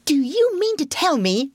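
high, indignant exclamation; mild shout. A narrator's reading. Rat thumping the table at Toad, mildly shouting. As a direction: exclaim in high-pitched disbelief, without full volume. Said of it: "shouted the rat".

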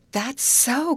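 high-pitched sudden agreement; excited cry. A narrator's reading. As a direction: cry out as the idea strikes you. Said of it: "cried Molly".

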